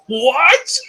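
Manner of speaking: high, rising mock yell of someone deafened; high-pitched, can't-hear-you query; deafened "what?". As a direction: act out not hearing a thing. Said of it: "I can't hear anything".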